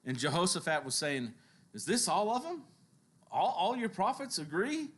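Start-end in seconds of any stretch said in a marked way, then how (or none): 1.7-4.9 incredulous. Acted. King questioning the unanimous prophets.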